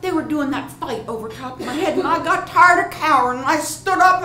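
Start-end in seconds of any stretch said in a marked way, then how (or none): 2.0-4.2 high, loud storytelling build; worked-up defiance. A narrator's reading. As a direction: build boldly towards the outburst. Fed up, rises to the fight.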